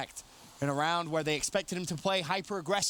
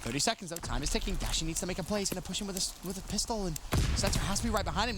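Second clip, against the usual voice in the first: ordinary talk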